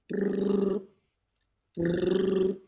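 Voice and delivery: trilled brrr of a ringing phone; phone ring imitation. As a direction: roll the lips like an old phone ring.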